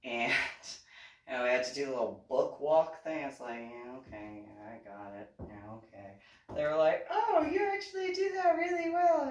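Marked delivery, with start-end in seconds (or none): 3.7-6.1 retold low, soft mumbled agreement; low-key compliance. Unenthused reply to walking drill. 7.1-9.3 high-pitched mimicked compliment; imitated gushing praise. Voicing the pageant organizers' praise.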